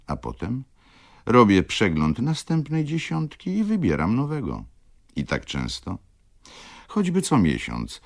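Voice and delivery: lightly voiced lively widow, wry and animated; lekko zagrana żywa wdówka. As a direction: play the worldly widow sizing up her suitors, lightly. Widow explains her amusements. (lekko odegrana, żywa i kpiąca wdówka)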